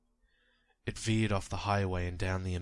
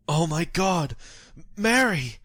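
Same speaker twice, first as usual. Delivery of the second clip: put-on anguished old man, much higher and louder; old man crying out in horror; 10 semitones higher, 9 dB louder than usual. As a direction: scream her name in panic as a frantic old husband. Old man sees wife hit.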